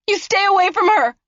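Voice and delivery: high, loud furious shriek. Acted. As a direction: protect her sister, scream. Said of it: "Zoe shrieked".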